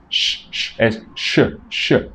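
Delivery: "sh" als lang, stemloos gesis; sh sound. Shown as a demonstration. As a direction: blaas lucht tussen de tanden: "sh". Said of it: "Es como abrir un poco los dientes".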